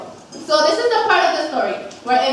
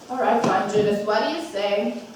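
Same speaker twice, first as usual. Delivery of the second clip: quieter coaxing; 7 semitones lower, 5 dB quieter than usual. Retold or imitated. Switching to soft coaxing.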